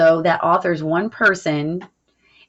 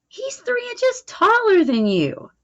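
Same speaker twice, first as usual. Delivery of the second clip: much higher voice for the friend Kevin; Kevin's voice. Acted. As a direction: pitch jumps up to voice the doubting friend. Rereading Kevin's line again.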